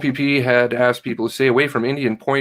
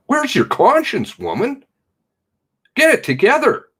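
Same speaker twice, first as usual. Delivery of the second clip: outraged scolding; 13 semitones higher, 3 dB louder than usual. Real, not acted. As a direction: shame her into listening. Scolding the chief.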